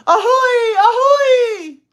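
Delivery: very high, loud wailing cry; wailing ahoy. Acted. Little girl wailing train noises. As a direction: wail it out like a whistle. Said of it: "and wailed".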